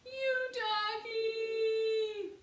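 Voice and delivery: very high, loud, very slow sung-out wail; little girl's long sobbing wail. A narrator's reading. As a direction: stretch the dog's name into a long wail. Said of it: "Honey wailed".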